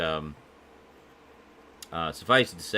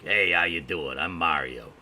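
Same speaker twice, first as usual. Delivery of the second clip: gruff cartoon Mario; 4 dB louder than usual. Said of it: "it was more kinda gruff".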